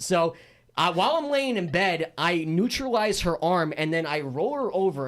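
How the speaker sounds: usual speaking voice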